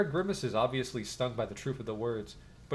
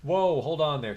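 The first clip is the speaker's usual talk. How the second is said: loud warning; 4 semitones higher, 7 dB louder, slower than usual. Acted. Guard stopping intruders.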